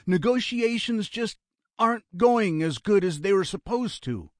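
high, pressing voice; anxious reasoning. A narrator's reading. Brother making excuses for stalled negotiations, anxious insistence. Brother pleads that talks continue.

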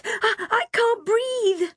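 high, drawn-out gasping panic; panicked yelp. A narrator's reading. Kim having a panic attack, yelping. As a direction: stammer it out in panic. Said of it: "she yelped".

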